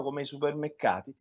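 usual speaking voice

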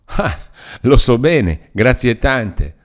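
bitter irony, dragging the thanks; sarcastic retort. A narrator's reading. Uncle mocks his niece with loud sarcastic thanks.

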